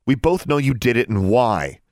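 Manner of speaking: scowling retort. A narrator's reading. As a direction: throw the blame back at her. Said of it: "Alexandra scowled at her".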